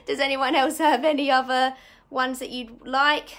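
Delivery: laughing through a question to the class; a real laugh spilling into the words. Real, not acted. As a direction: still giggling after admitting the rookie mistake. Laughing at her own mistake.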